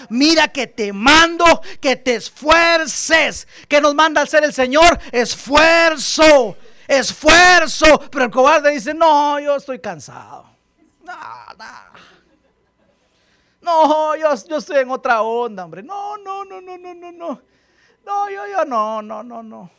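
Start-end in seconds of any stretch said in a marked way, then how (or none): 0.1-3.5 slow, loud, high proclaiming shout; shouted command. Acted. Pastor shouts God's command to Joshua. Preacher quotes God to Joshua. 5.1-8.0 slow, loud, drawn-out shout; shouted one word. Real, not acted. Pastor shouts the key word at the congregation. 8.9-19.7 high whiny protesting voice; mock coward. Pastor mocks the coward's excuses to the congregation.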